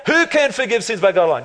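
quick run through the verse; hurried rereading. Real, not acted. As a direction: rattle it off to move along. Hurries through verse to next slide.

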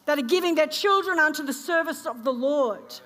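loud, rising sermon delivery; preaching. Acted. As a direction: preach it with full force. Preaching at full force.

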